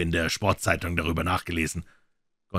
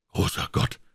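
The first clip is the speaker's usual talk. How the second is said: a whispered gasp of horror; breathless with fright. A narrator's reading. Stephen realizing how his father died, breathless horror. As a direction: breathe it out in shock. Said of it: "rief Stephen atemlos vor Schrecken".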